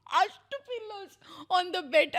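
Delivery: high-pitched words squeezed through laughing; slow, real laughing speech; helpless laughter. Real, not acted. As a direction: crack up while talking. Cracks up over pillow quarrel.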